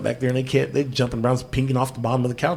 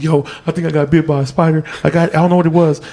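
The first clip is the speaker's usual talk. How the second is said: higher, louder panicked rambling; retold freak-out; 5 semitones higher, 5 dB louder, faster than usual. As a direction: re-enact freaking out over a possible bite. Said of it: "I'm fucking freaking out".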